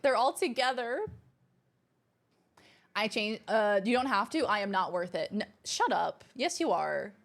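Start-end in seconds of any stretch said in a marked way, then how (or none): none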